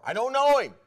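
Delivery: high, loud shouted denial; vehement, acted outburst of disowning; swearing denial. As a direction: swear it at full voice. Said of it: "And he swore".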